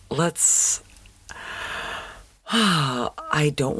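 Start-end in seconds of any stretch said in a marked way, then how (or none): 1.3-2.1 soft, breathy sigh; heavy sigh. Real, not acted. Host pauses after the clip before giving an opinion, soft sigh. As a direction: let out a long sigh before commenting.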